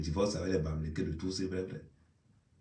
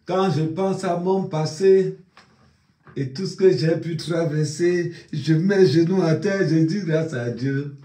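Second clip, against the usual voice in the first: slow, higher, grateful declaration; mock solemn speech; 6 semitones higher, 5 dB louder, slower than usual. Parodying a victor thanking God, slow and solemn. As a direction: deliver it like a humble winner's speech.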